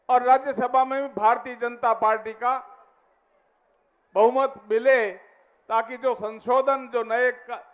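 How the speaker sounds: ordinary delivery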